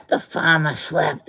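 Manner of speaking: slow, heavy Boston accent imitation. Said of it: "in his heavy Boston accent".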